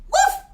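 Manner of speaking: playful dog bark, way up high; woof. Pretend. Host barking hello to the livestream viewers.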